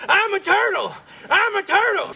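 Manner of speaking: high, silly cartoon voice; high silly turtle voice. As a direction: squeak it like a goofy turtle. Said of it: "I'd be like".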